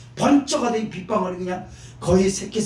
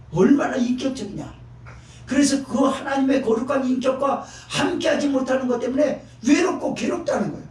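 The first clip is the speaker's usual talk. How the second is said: high, raised sermon cry; preaching at full force; 5 semitones higher than usual. Acted. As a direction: drive the point home from the pulpit. Preaching climax, shouted. (높고 힘찬 설교 외침)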